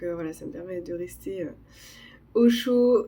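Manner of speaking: ordinary delivery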